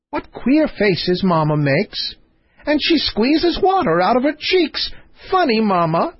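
a carefree girl mocking her mother's tears; giggly princess. Princess laughing at her crying mother, high and gleeful.

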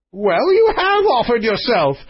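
high-pitched testy king; sharp retort. Acted. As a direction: retort as an exasperated monarch. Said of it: "retorted the king".

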